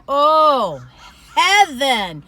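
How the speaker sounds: loud, swooning delight; rapturous. Real, not acted. Raving about apple cake, loud and rapturous. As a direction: cry out as if tasting heaven.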